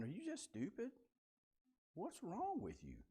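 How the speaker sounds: blunt exasperation. Retold or imitated. Teacher voices Jesus, put out with disciples, exasperated. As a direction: ask it like he's fed up with them. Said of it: "I think he's implying".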